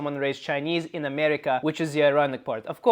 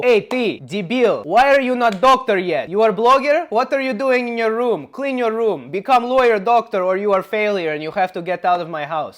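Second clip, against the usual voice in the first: loud, higher-pitched scolding father; strict immigrant dad; 3 semitones higher, 6 dB louder than usual. Acted. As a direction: berate your child like a demanding Slavic dad. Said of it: "Both Chinese dad and Slavic dad go".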